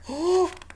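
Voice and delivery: sharp sneezy intake of breath; sneeze build-up gasp. Acted. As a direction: gasp before a big sneeze. Bear about to sneeze.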